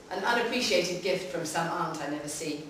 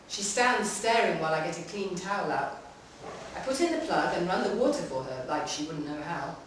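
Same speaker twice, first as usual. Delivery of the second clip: ordinary delivery, nothing marked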